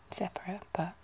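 normal talking voice

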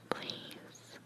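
barely audible begging whisper; whispered plea. Acted. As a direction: plead on a bare breath. Pleading acted in story.

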